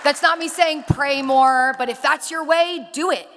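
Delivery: loud, slow, emphatic rally; rousing. Real, not acted. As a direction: drive each phrase home to the crowd. Rallying climax of speech.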